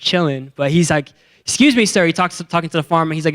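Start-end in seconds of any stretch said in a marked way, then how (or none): 1.4-2.1 raised-pitch polite call; calling out politely. Retold or imitated. Young man flagging down a farmer, polite call. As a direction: get the farmer's attention, pitched up.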